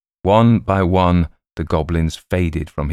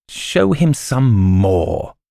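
very slow, high, loud goblin command; goblin king voice; 6 semitones higher, 4 dB louder, much slower than usual. Acted. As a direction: draw out the royal order. Goblin king commands his court.

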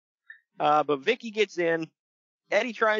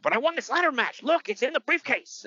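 Eddie's protest, pitched much higher; 10 semitones higher than usual. Retold or imitated. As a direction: plead your case in a high, whiny voice. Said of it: "He's like".

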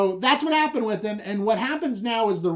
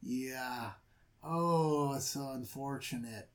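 soft, quiet uncomfortable mumbling; awkward dad; 6 semitones lower, 14 dB quieter than usual. Retold or imitated. Father squirming out of the tent, quiet and awkward. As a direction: back away awkwardly, quietly. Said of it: "He's like".

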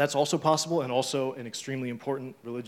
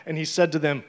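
scripture reading; 4 semitones higher, 7 dB louder than usual. Acted. Reads scripture aloud.

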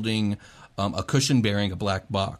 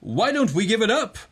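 high, loud goblin announcer; booming prize announcer; 11 semitones higher, 3 dB louder than usual. Acted. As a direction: hype up the crowd like a goblin MC. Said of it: "that goblin announcer from the actual tournament".

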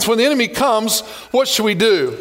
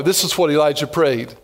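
ordinary delivery

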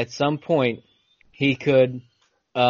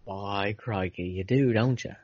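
mock Aussie reply; 6 semitones lower, 8 dB quieter than usual. Teasing his cohost about a website, a soft mock Australian accent. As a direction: answer in a quiet, low, fake Australian accent.

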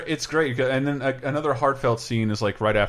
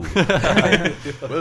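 laughing wise guy whoa; drawled wise guy whoa; smirking wise guy; 3 semitones higher, 3 dB louder than usual. Retold or imitated. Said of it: "just goes".

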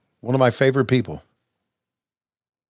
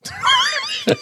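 laughs. Real, not acted. Laughs at his own compliment.

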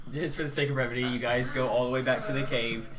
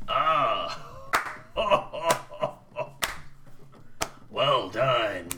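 goblin boss; 3 semitones lower, slower than usual. Acted. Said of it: "he's like".

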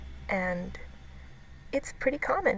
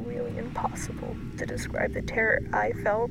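hushed, breathy storytelling; whispered horror narration. A narrator's reading. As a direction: whisper it like a scary secret.

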